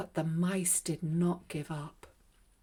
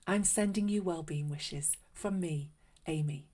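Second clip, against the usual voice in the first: softer, hushed host voice; gentle children's host closing the show; soft storytime chat; 2 semitones lower, 6 dB quieter, faster than usual. Acted. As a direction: talk gently to the little listener. Host chats after the story.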